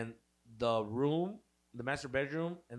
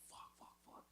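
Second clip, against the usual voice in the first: whispered panic behind the door; frantic whispered swearing; panicked whisper; 27 dB quieter than usual. Retold or imitated. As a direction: hiss the curses under your breath.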